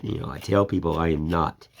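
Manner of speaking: ordinary talk, nothing marked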